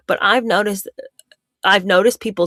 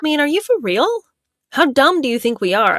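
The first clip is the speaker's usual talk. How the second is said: incredulous scoff; 8 semitones higher than usual. Real, not acted. Mocking a scammer's story.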